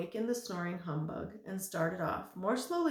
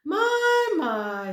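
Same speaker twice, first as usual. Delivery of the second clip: high fussy my my; 12 semitones higher, 12 dB louder than usual. A narrator's reading.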